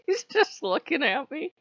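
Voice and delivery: said through laughter; laughing. Real, not acted. Laughing as she recalls the god staring, amused. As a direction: giggle through the words.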